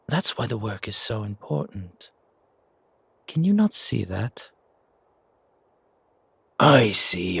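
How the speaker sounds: a hint of a stern, teasing mother; elder mother figure. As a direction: chide the boy with amused authority. Mother figure scolding the wolf.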